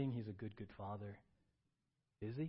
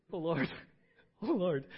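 loud, high, laughing "Oh, Lord"; retold frantic prayer; 6 semitones higher, 11 dB louder than usual. Preacher reliving her terrified prayer, loud and high. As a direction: replay the panic of the moment, half laughing. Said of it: "prayer gets real simple when you're terrified".

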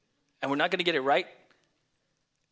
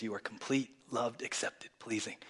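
quiet reassurance; 7 dB quieter than usual. Retold or imitated. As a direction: murmur it as a private reminder. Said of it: "that audience of one says".

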